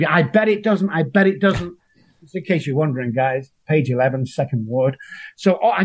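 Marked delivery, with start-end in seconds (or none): 0.1-0.9 mocking doubter. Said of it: "people go". 0.9-1.7 higher, smug put-on voice for "I bet it doesn't"; mock doubter voice. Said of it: "people go".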